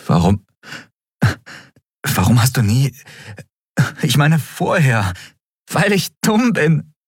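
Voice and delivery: half sob, half laugh; laughing and crying. Acted. Endriel confesses to Kai, laughing through tears. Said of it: "lachte und weinte gleichzeitig".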